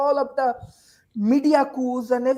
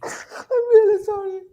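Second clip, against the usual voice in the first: high, tearful, breaking voice; crying; 12 semitones higher than usual. Real, not acted. Panelist mourning the killed young generation, weeping. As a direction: speak through real tears. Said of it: "I'm very emotional now".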